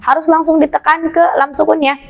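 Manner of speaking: usual speaking voice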